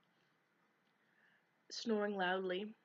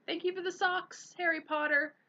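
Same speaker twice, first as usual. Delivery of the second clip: much higher, louder call; miserable call; 11 semitones higher, 7 dB louder than usual. A narrator's reading. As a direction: call out after them, unhappy. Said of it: "Dobby called miserably".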